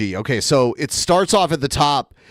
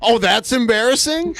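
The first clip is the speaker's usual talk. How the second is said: mock surprised echo; 7 semitones higher than usual. Real, not acted. Friend echoes embarrassing back in mock disbelief, higher.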